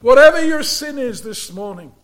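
measured pulpit preaching, rising on key words. Acted. Preacher warning the congregation about unbelief, earnest sermon delivery.

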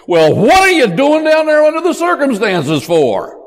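high, incredulous mock holler; Howard Hendricks. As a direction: imitate a professor's astonished comeback. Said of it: "classic Howard Hendricks".

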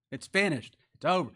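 ordinary talk

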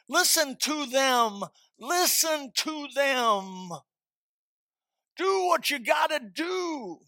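high-pitched, insistent sermon voice; emphatic preaching. Real, not acted. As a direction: press each warning on the congregation from up high. Preaching against addiction and overeating.

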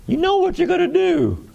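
excited pitch jump. Real, not acted. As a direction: lift it high, you know it. Excited rhetorical to congregation.

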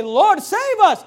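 high, loud, slow plea; desperate plea. Acted. Disciples begging Jesus on the stormy sea, loud slow plea. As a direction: cry out for help, drawn out.

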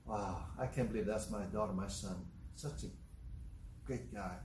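low, hushed musing of a proud parent; soft, marvelling self-talk; said to oneself. Acted. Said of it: "to yourself".

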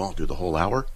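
ordinary talk, nothing marked

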